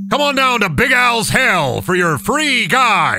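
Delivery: loud, high, slow car-dealer announcer; mock ad pitch. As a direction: sell it like a cheesy local TV ad.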